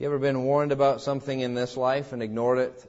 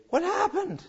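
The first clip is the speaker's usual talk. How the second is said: shocked question; 13 semitones higher, 5 dB quieter than usual. Retold or imitated. People waking up in hell.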